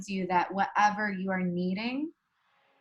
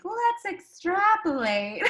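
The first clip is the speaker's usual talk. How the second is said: high, slow, laughing "let's extrapolate"; playful mock suggestion; 10 semitones higher, 4 dB louder, slower than usual. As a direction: tease yourself with a grand suggestion. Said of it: "I'm like".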